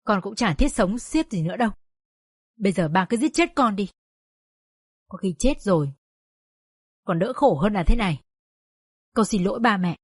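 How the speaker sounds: hopeless daughter apologising; weary despair. Acted. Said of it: "Hồng chán đời và nói". (chán đời, buông xuôi)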